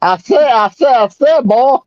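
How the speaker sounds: blustering Southern cartoon rooster, pitch climbing; Foghorn Leghorn. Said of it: "listen to Foghorn Leghorn".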